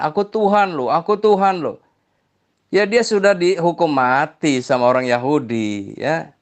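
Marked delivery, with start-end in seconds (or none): 0.0-1.8 mild mock yell. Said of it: "dia teriak-teriak di jalan".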